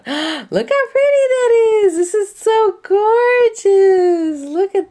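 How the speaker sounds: high, loud, slow awed gush; gushing squeal. Real, not acted. Crafter showing viewers a pretty piece, loud high gush. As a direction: melt over it out loud.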